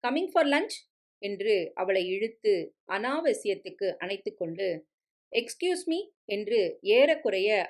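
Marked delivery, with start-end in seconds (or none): 0.1-0.9 breezy husband. A narrator's reading. Husband sweeps wife away. 5.3-6.1 breezy excuse me. A narrator's reading. Husband excuses himself, leaving.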